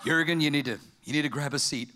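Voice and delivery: low-pitched, serious doctor voice; mock-solemn physician; grave doctor. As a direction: break bad news in a deep voice. Acted doctor breaking bad news.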